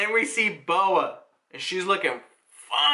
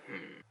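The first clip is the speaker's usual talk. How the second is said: very low, quiet "hmm"; barely audible low hum; pensive hum; 13 semitones lower, 20 dB quieter than usual. Acted. Mihawk merely hums in response.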